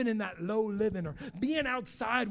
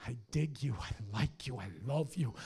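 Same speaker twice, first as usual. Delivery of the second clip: tender; 5 semitones lower than usual. Acted. God tenderly telling the listener he loves them. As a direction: say it gently, close.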